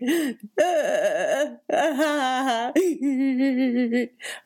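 shaky, high laugh near tears; crying chuckle. Retold or imitated. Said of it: "cry chuckles".

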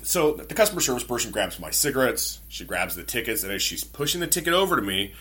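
normal talking voice

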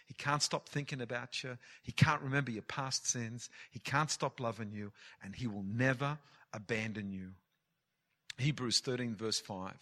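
ordinary delivery

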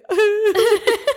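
high-pitched mock celebrity sob; Kim Kardashian crying. Friend showing how she cried in back, high mock wail. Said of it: "like Kim Kardashian when she cried".

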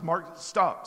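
firm insistence. Retold or imitated. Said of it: "he stops me and goes".

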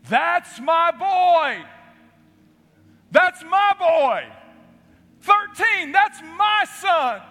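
slow, high, loud yell across the park; proud father's scream. Retold or imitated. Father calling his son from the stands, a slow proud scream. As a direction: shout it out with all your pride. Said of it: "screaming to the top of his lungs".

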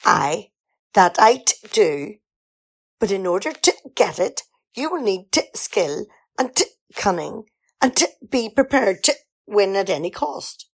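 stumbling over the written "t-" in a halting, lightly northern read; forced "t" dialect. Acted. Reading the writer's dialect lines to Jonathan, halting and forced. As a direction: reluctantly read awkward dialect lines, stuttering on each "t".

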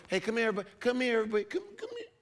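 high, cooing call to come here; mock baby talk. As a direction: coax them in like pets. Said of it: "God did not call me to go".

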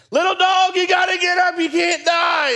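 very high, loud panicked shout; screaming at the dog. Retold or imitated. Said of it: "I'm screaming at a deaf dog".

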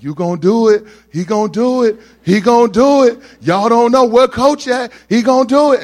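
high, loud hollering from the back; hype-man yelling. Retold or imitated. Gym buddy rallying everyone as the lifter loads the bar, high excited yelling. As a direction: holler it like a fired-up teammate.

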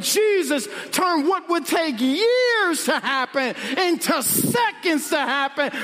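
slow, rising, shouted build to the miracle; preaching shout. Acted. Preacher to congregation, slow rising shout. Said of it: "preacher builds to a shout".

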